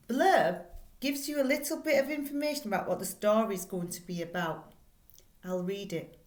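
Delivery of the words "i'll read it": usual speaking voice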